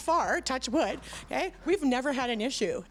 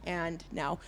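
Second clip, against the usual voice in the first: ordinary talk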